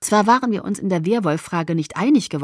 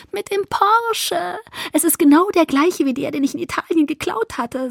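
happy sigh; 10 semitones higher, 3 dB louder than usual. A narrator's reading. Alice schwärmt Bella von ihrem Porsche vor, glücklich. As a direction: swoon over the car. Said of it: "sie seufzte glücklich".

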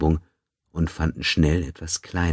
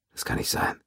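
whisper; 7 dB quieter than usual. A narrator's reading. Stunned reporter reading secret files.

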